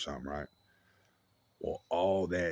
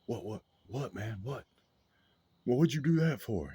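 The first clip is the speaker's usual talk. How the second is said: bewildered protest; 5 semitones higher than usual. Retold or imitated. Buddy slapped without warning.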